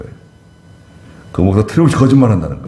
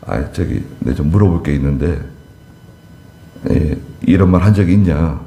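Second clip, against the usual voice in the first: gentle asking; 5 semitones lower than usual. Shown as a demonstration. Preacher showing how to ask a colleague, gentle low-key voice. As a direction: model a calm, polite question. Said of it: "점잖게".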